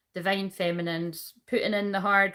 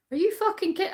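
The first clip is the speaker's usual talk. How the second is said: retold outrage, much higher; incredulous, offended retort; indignant; 12 semitones higher, faster than usual. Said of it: "And I'm like".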